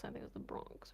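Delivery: unvoiced, unsure whisper; whispered doubt. Real, not acted. Doubting where friends lived, whispered to himself. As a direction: mutter it to yourself.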